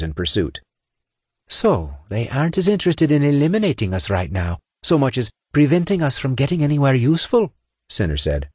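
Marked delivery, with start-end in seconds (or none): 1.5-7.5 lightly voiced squad leader; a hint of a tough officer; commander voice. A narrator's reading.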